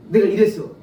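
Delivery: normal talking voice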